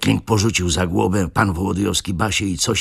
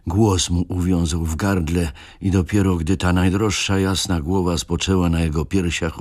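ordinary delivery

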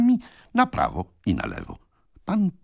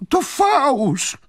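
much higher, loud outraged denial; indignant cry; 5 dB louder than usual. Acted. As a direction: Pickwick protesting his innocence. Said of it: "zawołał pan Pickwick". (wysoki, głośny, oburzony okrzyk)

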